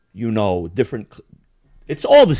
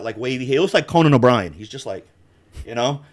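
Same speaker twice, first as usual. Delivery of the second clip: ordinary delivery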